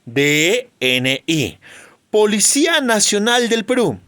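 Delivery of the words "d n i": D N I read slowly, each letter named apart; letters spelled out. Shown as a demonstration. Teacher showing the class how an acronym is read, letter by letter.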